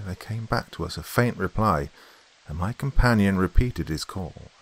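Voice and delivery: ordinary delivery, nothing marked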